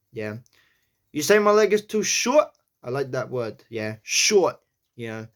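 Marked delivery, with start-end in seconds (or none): 1.1-2.5 New York accent. Shown as a demonstration. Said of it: "I'll say that one more time". 4.0-4.6 "Short" said quick and clipped in a New York accent, higher. Shown as a demonstration. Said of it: "It's very quick".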